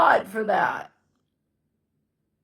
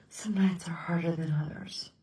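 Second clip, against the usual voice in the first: whisper; 4 semitones lower, 12 dB quieter than usual. Real, not acted. Quiet reflection after crying.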